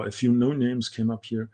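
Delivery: ordinary delivery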